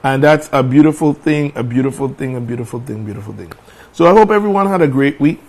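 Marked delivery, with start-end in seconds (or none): 1.6-3.5 each repeat softer until it fades out; fading echo. Acted. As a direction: let the phrase die away like an echo. Host repeats phrase fading away.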